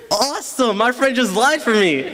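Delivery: high-pitched, gleeful mock relief; elated relief. Boy retelling relief as friend lied for him, elated.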